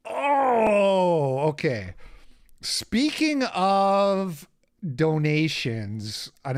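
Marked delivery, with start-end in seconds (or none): 0.1-1.4 loud, high drawn-out "oh"; exasperated groan. Real, not acted. Streamer groaning before getting back on track. 2.6-6.3 slow, stretched "speaking of donations"; drawn-out segue. Real, not acted. Streamer slowly leading into the donation announcement.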